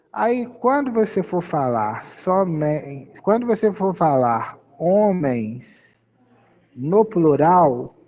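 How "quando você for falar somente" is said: ordinary talk, nothing marked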